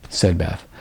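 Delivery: plain reading voice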